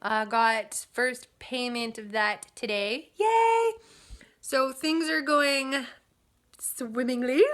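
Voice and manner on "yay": high, louder excited yay; excited cheer. Real, not acted. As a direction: celebrate the payment out loud. Cheering first payment.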